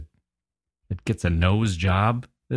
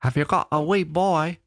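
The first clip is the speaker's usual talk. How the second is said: put-on much higher, childlike voice asking about a wee boy; little Scottish girl; 10 semitones higher than usual. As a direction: imitate a small girl's piping question. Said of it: "That's what I heard".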